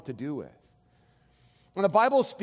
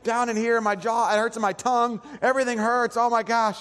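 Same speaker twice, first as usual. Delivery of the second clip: complaining wife; 6 semitones higher than usual. Retold or imitated. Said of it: "she's like".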